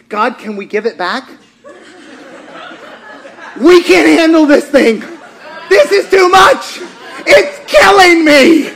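loud, high exasperated plea, laughing; hammed-up overwhelmed parent begging God; mock desperate parent.

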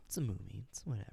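soft whisper; whispered shrug. Real, not acted. Calming friend about the movie, whispered. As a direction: brush it off in a whisper. Calm dismissal.

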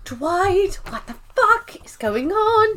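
mock shrill Nellie. Nellie baffled by Dwight, high exasperated mock cry. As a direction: squeal it in disbelief.

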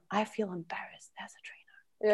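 hushed, breath-only whisper; same words dropped to a secretive whisper; whispered confession. Acted. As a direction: whisper it as an embarrassed admission.

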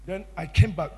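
ordinary talk

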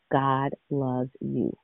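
each word slow and tender, God loves you; slow, tender blessing. Shown as a demonstration. Preacher telling the listeners God loves them, slow and tender. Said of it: "listen to my tone".

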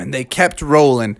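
usual speaking voice

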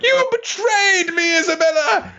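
high, cracking cry of betrayal; anguished betrayed shout. Retold or imitated. Friend retelling manga scene, Ratri screams at Isabella in anguish. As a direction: wail it like a betrayed villain. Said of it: "And Roger's like".

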